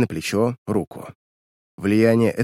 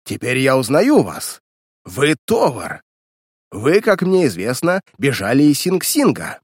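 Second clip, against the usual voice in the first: heated, emphatic unmasking; furious accusation; 3 semitones higher, 3 dB louder than usual. A narrator's reading. Weston unmasking the escaped convict, furiously. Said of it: "вне себя от ярости закричал Вестон".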